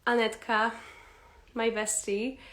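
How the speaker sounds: normal talking voice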